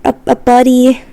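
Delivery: playful squeaker voice; squeaky little guy voice. Pretend. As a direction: squeak it out like a tiny harmless critter. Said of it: "a squeaker".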